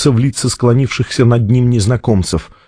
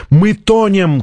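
high, urgent cry; acted shout without much volume; alarmed shout; 7 semitones higher, slower than usual. A narrator's reading. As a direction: call out the disaster, pitch raised. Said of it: "крикнул кто-то из техников".

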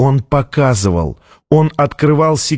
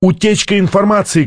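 high, urgent warning cry; acted shout, volume held; alarmed shout; 7 semitones higher than usual. A narrator's reading. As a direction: call out the alarm, pitch raised. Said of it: "кричал кто-то".

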